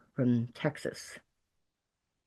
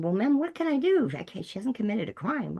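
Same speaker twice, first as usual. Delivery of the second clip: put-on higher-pitched Texas police officer accent; 9 semitones higher than usual. Texas policeman telling the mother on the phone he can't act, Texas accent. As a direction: voice a small-town Texas cop in a Texas accent. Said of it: "I can do the accent pretty good".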